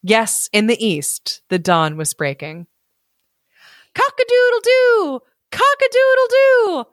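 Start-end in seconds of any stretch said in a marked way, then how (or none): 3.9-6.8 much higher, ringing rooster call; rooster crow. A narrator's reading. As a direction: crow like a cockerel at dawn. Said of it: "began suddenly to crow".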